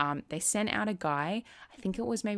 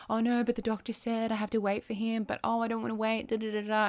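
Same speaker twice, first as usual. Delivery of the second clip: higher, whiny mock panic; playful send-up of her own past worrying; mock freak-out; 5 semitones higher than usual. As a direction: imitate her old anxious self fretting. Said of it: "would have been freaking out going".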